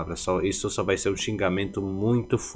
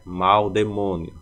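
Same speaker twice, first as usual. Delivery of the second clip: ordinary talk, nothing marked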